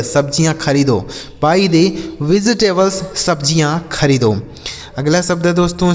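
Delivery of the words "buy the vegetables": slow, careful English question; slow model reading. Shown as a demonstration. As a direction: read it out slowly for learners. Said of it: "slow model phrase".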